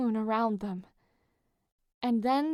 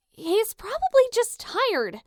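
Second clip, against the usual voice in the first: much higher, louder defensive protest; defensively; 11 semitones higher, 5 dB louder, slower than usual. A narrator's reading. Piper defends Jason to Leo, defensive. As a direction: push back hard. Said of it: "Piper said defensively".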